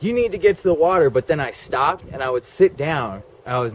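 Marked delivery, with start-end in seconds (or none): 0.0-1.1 higher, louder, fast urgent push; urgent inner drive. Retold or imitated. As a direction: rush it out like a compulsion. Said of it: "I was like".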